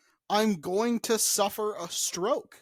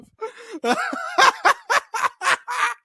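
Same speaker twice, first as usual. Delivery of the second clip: high cackling laugh; 7 dB louder than usual. Real, not acted.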